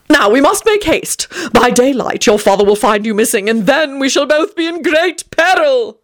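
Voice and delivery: high, urgent, theatrical warning; prim melodramatic alarm; fussy Tony. A narrator's reading. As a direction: press the child to hurry. Tony urges haste.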